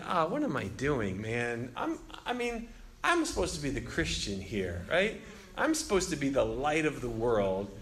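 low, soft self-reproach; retold remorse, dropping low and quiet; heart sinking. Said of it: "my heart sank".

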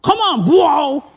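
high, hammed-up shouts of a zealous churchgoer; mock screaming out. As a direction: cheer the preacher on, half-mock yell. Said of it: "he's just kind of screaming out".

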